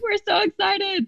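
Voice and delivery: high, slow sing-song cheer; chorus of happy staff; high drawn-out mock cheer. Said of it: "the staff is like".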